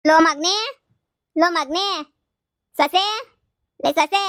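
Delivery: loud, high calls across the house; calling out. Acted. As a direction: shout for the son and daughter-in-law to wake up. Mother-in-law calls son and daughter-in-law. (ಮಗ ಮತ್ತು ಸೊಸೆಯನ್ನು ಜೋರಾಗಿ ಕೂಗುವುದು)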